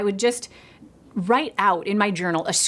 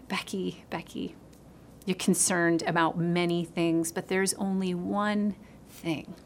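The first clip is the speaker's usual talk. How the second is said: tender whisper; 5 dB quieter than usual. Retold or imitated. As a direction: whisper gently to a tired mother. Jesus speaking to her, retold.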